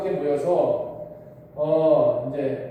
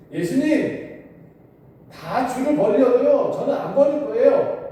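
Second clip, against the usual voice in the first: higher, louder boastful shout as Peter; loud outcry; 8 semitones higher, 5 dB louder than usual. A narrator's reading. Peter boasting to Jesus before everyone, loud proud outcry. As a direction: Peter crying out that he will never leave, big and loud. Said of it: "베드로가 갑자기 큰 소리로 외쳐요".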